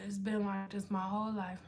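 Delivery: low, very soft murmur; quiet mutter. Real, not acted. Streamer answering a viewer about eyebrows, quiet mutter. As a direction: say it under your breath.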